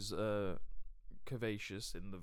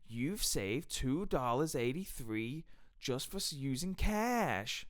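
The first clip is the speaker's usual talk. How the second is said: drawn-out, high, loud game-show delivery; imitating a wildly enthusiastic cashier; over-the-top enthusiasm; 5 semitones higher, 3 dB louder, slower than usual. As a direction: announce it like a huge prize win. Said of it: "she was so enthusiastic".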